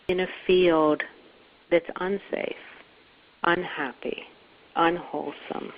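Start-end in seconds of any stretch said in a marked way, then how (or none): none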